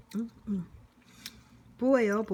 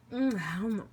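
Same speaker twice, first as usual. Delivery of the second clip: ordinary talk, nothing marked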